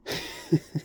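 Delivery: short pained laugh; a hollow little laugh; bitter breathy laugh. Real, not acted. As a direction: laugh that hides hurt. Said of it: "I'm laughing because I have a lot of pain".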